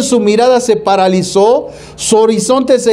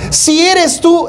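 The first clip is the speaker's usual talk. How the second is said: high, slow, drawn-out doubtful Peter; doubting Peter; 8 semitones higher, slower than usual. Retold or imitated. Peter to Jesus on the water, mock doubtful and slow. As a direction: stretch it out like a skeptic. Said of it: "Pero Pedrito, ay".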